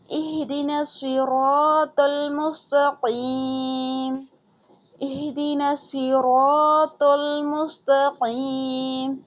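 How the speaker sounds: slow chanted नअबुदु व इय्याका नस्तईन; Quran recitation. Shown as a demonstration. Teacher reciting for students, slow and melodic. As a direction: recite the verse slowly and melodically.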